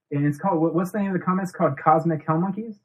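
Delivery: usual speaking voice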